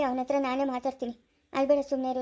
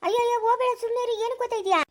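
much higher, pleading alarm; higher, anxious wife begging him to stay; alarmed protest; 9 semitones higher, faster than usual. Acted. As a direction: stop him from rushing off, voice leaping up. Wife alarmed he's leaving.

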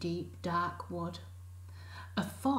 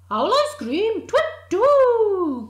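owl shrieks far higher and louder; panicked owl; 13 semitones higher, 14 dB louder than usual. Acted. Owl fleeing in a high screech. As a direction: screech and flap away.

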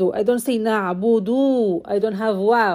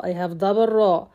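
ordinary talk

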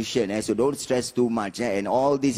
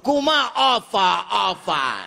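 high, slow holler that drops away; auntie calling out; 7 semitones higher, slower than usual. Retold or imitated. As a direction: shout it out slowly like a nosy auntie. Auntie yells as in line 77.

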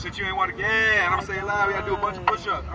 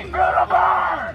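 drill sergeant shout; 8 semitones higher, slower than usual. Acted. Coaching through push-up count.

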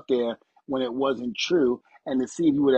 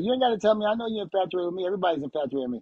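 teasing Stephanie; 6 semitones higher, much faster than usual. Retold or imitated. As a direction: toss it off like a joking diva. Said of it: "she's like".

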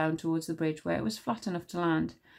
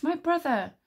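higher-pitched plaintive call; crying out; 9 semitones higher than usual. A narrator's reading. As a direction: call out high to someone out of reach. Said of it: "Blue cried from the arch".

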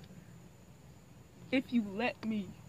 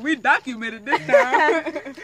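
slow words through laughter; laughing; 8 dB louder, slower than usual. Retold or imitated. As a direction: laugh out the quote. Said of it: "I was like".

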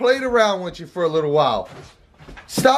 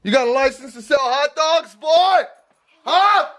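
high tough cop voice; 9 semitones higher than usual. Acted. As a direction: grill him like a street cop. Dad plays cop questioning son.

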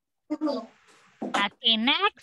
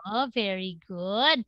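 ordinary talk, nothing marked

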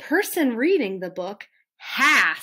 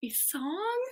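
horrified little whisper; 2 semitones higher, 11 dB quieter than usual. Acted. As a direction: breathe it in dread. Reader dreading singing.

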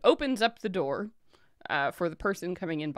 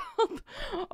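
high jolly giggle; high merchant giggle; 4 dB quieter than usual. Acted. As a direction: giggle with delight. Merchant laughs.

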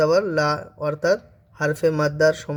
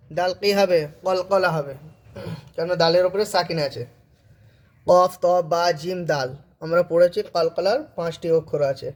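usual speaking voice

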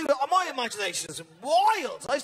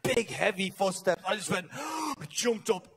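ordinary delivery

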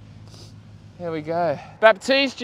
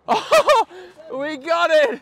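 high, laughing with delight; thrilled laughing shout; laughing excitedly; 11 semitones higher, slower than usual. Real, not acted. Huge find pulled from water.

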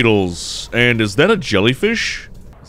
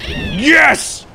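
high-pitched triumphant yes; excited cheer. Real, not acted. Player cheering his fish on, excited cheer.

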